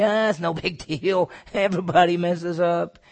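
mock shrug. Mocking careless sinner.

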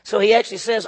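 ordinary talk, nothing marked

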